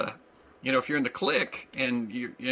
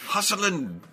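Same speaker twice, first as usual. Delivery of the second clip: put-on Scottish accent; 7 semitones higher, 5 dB louder than usual. Scottish insult generator game.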